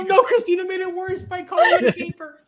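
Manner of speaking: high, giggling through the words; losing it over a bad pun; laughing hysterically. Real, not acted. Laughing hard at the chat's caper pun.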